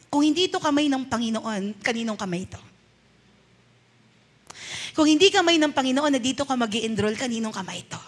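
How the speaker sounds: soft, gentle words to a son; tender counsel. Retold or imitated. Parent gently reassures sad son about new school. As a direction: reassure him quietly, lowering at each question.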